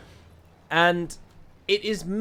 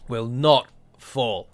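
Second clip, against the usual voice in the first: very slow, word by word; slow defiant vow; 3 dB louder, much slower than usual. Real, not acted. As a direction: let each word land like a hammer. Rallying oath to defenders.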